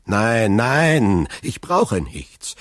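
loud drawn-out no, then slowing; flustered stammer. A narrator's reading. Shaken prosecutor waves off help, stammering. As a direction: refuse loudly, then falter. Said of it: "stammelte Villefort".

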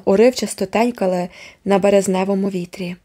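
ordinary delivery, nothing marked

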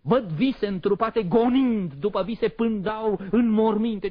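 high, loud, driven outcry; frenzied despair. Acted. Poet's despairing, maddened vision.

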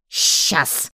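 breathless and loud, out of breath from the fight; winded snap mid-brawl; panting. A narrator's reading. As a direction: spit it out between gasps. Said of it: "тяжело дыша отозвалась я".

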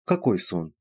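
usual speaking voice

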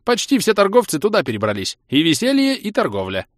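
a bouncy, lively elf; chirpy elf. Acted. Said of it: "ответил ушастый".

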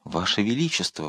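ordinary delivery

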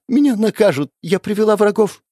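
put-on high, loud panicked girl's cry; frightened. Frightened girl voiced.

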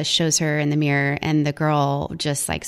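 normal talking voice

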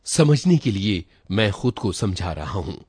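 ordinary delivery, nothing marked

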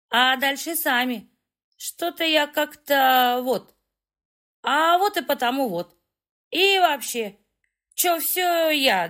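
put-on slow, high, slurring tipsy girl; town drunk. A narrator's reading. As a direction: drawl it out, tipsy and pleased. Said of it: "пьяно спросила". (пьяная растянутая речь)

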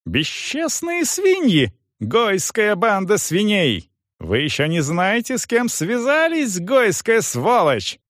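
high-pitched, drawn-out tirade; furious yelling. A narrator's reading. Young man abuses soldier and manager, furious. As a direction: rage on with strained, stretched insults. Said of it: "снова заорал Гирш".

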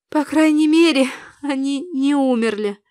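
understated wail; moaning. A narrator's reading. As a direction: groan it with weary relief. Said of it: "простонала я".

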